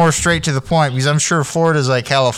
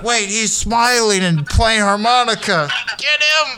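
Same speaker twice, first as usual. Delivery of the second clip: angry mob voice; 8 semitones higher than usual. Retold or imitated. Voices angry locals chasing him.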